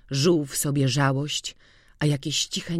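usual speaking voice

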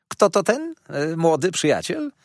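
high, loud curious question; nosy dwarf lady. Acted. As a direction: pipe up curious about the newcomer. Karlica pyta o gościa. (wysoko i ciekawsko)

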